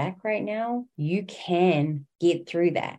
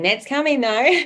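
higher and louder, "coming" punched with glee; playful excited tease; 8 semitones higher, 8 dB louder than usual. Real, not acted. Host teasing listeners about her coming memoir, loud and playful.

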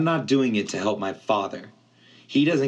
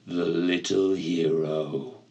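amused ancient voice; 3 semitones lower than usual. Acted. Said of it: "an amused voice echoed far down in the darkness".

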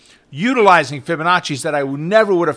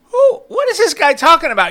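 shrill, much higher and louder mock indignation; outraged disbelief; 12 semitones higher, 5 dB louder than usual. Student scoffing at the coach who says he'll lose, mock outrage. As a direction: act the student insulted by the coach's warning.

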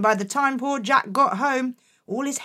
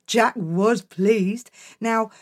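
usual speaking voice

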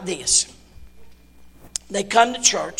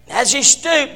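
normal talking voice

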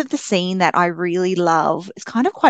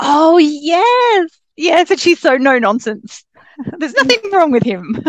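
high laughing agreement; 10 semitones higher, 5 dB louder, slower than usual. Real, not acted. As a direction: laugh through the words. Laughing agreement about grandma.

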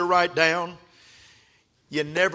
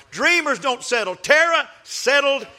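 louder preacher proclamation; preaching peak; 9 semitones higher, 5 dB louder than usual. Real, not acted. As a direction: hammer it louder. Preaching repetition building.

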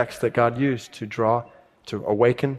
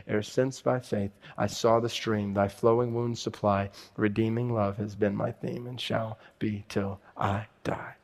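slow, softened hymn lines, spoken; reverent, fading close; solemn verse recital; 7 dB quieter than usual. A narrator's reading. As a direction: recite the verse slowly, sinking to a hush.

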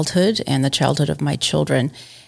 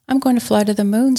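higher, quick excited cub voice; eager little bear; 6 semitones higher, faster than usual. Acted. Little Bear telling Mother Bear his plan, eager. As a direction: chirp it like a keen little cub. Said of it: "said Little Bear".

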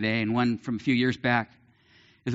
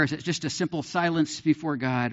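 normal talking voice

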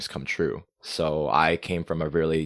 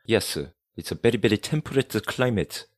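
higher, hammed-up boastful local voice; mock proud Korean; 4 semitones higher than usual. Poking fun at Koreans boasting of four seasons, mock proud voice. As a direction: lightly send up the proud local bragging about the seasons. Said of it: "So I like to poke fun at that myself".

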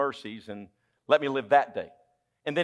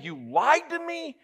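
pouting complaint; 10 semitones higher than usual. Acted. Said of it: "while he's pouting in the prison".

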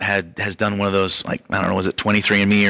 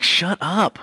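higher, scolding shut up; mock hush; 7 semitones higher than usual. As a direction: crowd telling the blind beggar to hush, sharply. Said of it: "they tell him, they're like".

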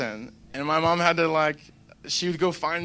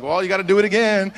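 louder, firmer parent voice; mock parent; 5 semitones higher, 9 dB louder than usual. Parent telling kids to retake pill, louder firm insistence. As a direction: insist like a tired mom. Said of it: "and so it's like".

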